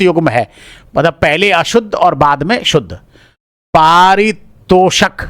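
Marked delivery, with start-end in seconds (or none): 3.7-5.2 पारितोषक stretched out slowly and louder; slow drawn-out reading. Shown as a demonstration. Teacher slowly reading the wrong spelling पारितोषक aloud. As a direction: drag out पारितोषक syllable by syllable. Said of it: "पारितोषक अशुद्ध है".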